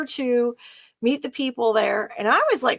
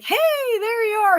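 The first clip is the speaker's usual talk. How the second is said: higher-pitched, lifted hail to a familiar face; friendly acquaintance's greeting; 11 semitones higher, 3 dB louder than usual. Retold or imitated. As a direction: greet a regular traveler by surprise. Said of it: "he'd be like".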